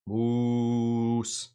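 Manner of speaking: "Moose" long and low in an Irish accent; low, stretched Irish "Moose"; Irish accent attempt. Shown as a demonstration. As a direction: try a drawn-out Irish "Moose". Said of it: "can you say moose in an Irish accent".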